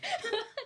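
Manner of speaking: high sudden laugh; a quick high laugh; burst of laughter. Real, not acted. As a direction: laugh out at the memory. Laughing at bad concert vibes.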